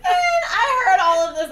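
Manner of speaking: loud and high through laughter; laughing loudly. Real, not acted. Recalling hiding in the closet, laughing loudly. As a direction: burst out laughing as you tell it.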